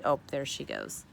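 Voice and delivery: plain reading voice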